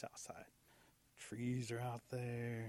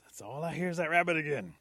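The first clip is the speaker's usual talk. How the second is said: loud, higher, fed-up outburst; exasperated eagle; 3 semitones higher, 8 dB louder than usual. Acted. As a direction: call out loudly, drawing out the summons. Fed-up eagle calls for panther.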